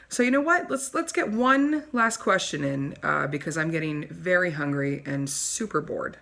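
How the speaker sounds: a self-adoring TV host preening for her audience; snooty talk show host. Acted. Wendy wraps up, bored.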